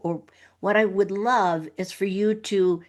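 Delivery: usual speaking voice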